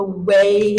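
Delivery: "way" held very long for emphasis; emphatic stretched WAY. Real, not acted. American teasing friend about stronger accent, "way" hugely elongated. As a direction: drag out the word "way". Stretches word to stress the difference.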